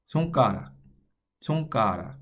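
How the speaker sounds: "손가락" clearly in Korean, flap in the last syllable; Korean songarak. Shown as a demonstration. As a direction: model "손가락" the Korean way for learners. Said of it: "The next word I would like you to try is".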